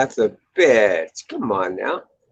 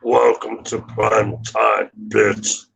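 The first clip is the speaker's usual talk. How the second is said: slow menacing slasher voice; drawn-out villain threat; Freddy Krueger voice; much slower than usual. Retold or imitated. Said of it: "I do Freddy Krueger pretty good".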